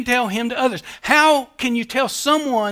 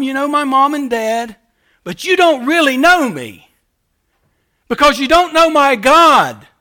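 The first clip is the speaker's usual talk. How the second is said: raised, louder challenge to the crowd; paraphrasing Jesus; 4 semitones higher, 5 dB louder than usual. Acted. Jesus confronting the religious crowd, raised and firm. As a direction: press the point, you don't know me. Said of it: "What he's saying is".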